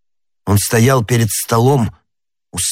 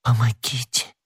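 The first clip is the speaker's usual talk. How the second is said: soft, failing plea; dying whisper; 2 semitones higher, 6 dB quieter than usual. Acted. Madame Bonacieux begs for help, dying whisper. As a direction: whisper it with fading strength. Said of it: "прошептала госпожа Бонасье".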